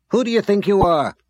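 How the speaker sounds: high, loud indignant snap; angry snap. A narrator's reading. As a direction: snap at him, outraged. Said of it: "she snapped".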